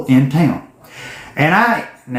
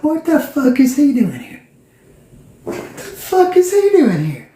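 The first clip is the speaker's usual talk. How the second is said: rising disbelief; 10 semitones higher than usual. Retold or imitated. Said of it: "I wasn't saying this out loud".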